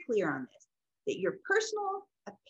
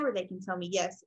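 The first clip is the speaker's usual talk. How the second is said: usual speaking voice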